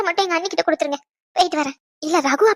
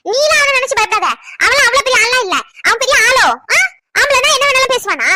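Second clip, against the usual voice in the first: high-pitched, combative scorn; outraged sister sticking up for her sibling; indignant defiance; 4 semitones higher than usual. Acted. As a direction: rile up, ready to confront him. Angrily defends upset younger sister.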